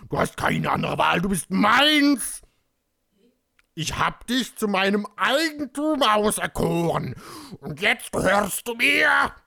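loud, hammed-up possessive demand; thundering. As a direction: boom it out, rising to a mock yell. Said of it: "donnerte er".

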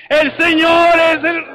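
loud, high, drawn-out rallying shout; grito de guerra. Shown as a demonstration. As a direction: shout it slowly for the crowd to join. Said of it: "pero grítenlo". (grito fuerte y alargado)

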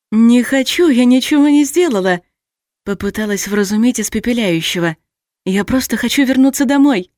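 high, loud desperate plea; pleading. A narrator's reading. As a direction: plead for your life, loudly. Heroine pleads with villain.

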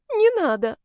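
high, plaintive whine; whimpering, pleading protest; tearful whine. A narrator's reading. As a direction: whine it high like someone near tears. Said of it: "плаксиво проныла".

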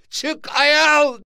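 loud, very high yell; shouting. A narrator's reading. Father orders his son out of the mosque, high shout. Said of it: "diye bağırdı".

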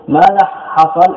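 normal talking voice

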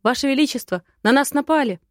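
a guard shouting news of an attack; alarmed report. Acted. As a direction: burst in with the alarm. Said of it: "чудовищные слова".

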